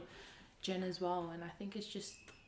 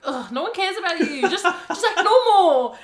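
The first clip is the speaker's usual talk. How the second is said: loud, high, exasperated mock complaint; annoyed cousin; 12 semitones higher, 21 dB louder than usual. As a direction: imitate a fed-up cousin, laughing through it. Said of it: "my cousins are annoyed".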